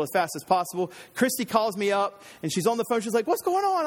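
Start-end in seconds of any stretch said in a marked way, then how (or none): 3.3-3.8 high-pitched imitation of a worried wife. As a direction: mimic your wife asking anxiously on a call. Wife phones during the emergency.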